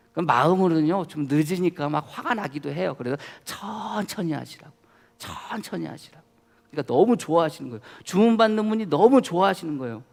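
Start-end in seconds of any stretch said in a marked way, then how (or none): 3.4-6.2 gentle reassurance. Retold or imitated. Repeating gentle words to waiter.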